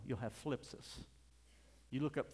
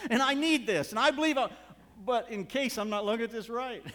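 loud, high-pitched sermon delivery; full-force preaching; 9 semitones higher, 13 dB louder than usual. Real, not acted. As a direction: drive it home to the congregation.

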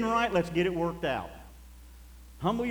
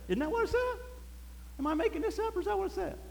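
pitched way up, asking the room; high questioning; 14 semitones higher, 4 dB quieter, faster than usual. Real, not acted. Preacher asks the congregation, high-pitched and teasing. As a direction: lift the voice high to ask.

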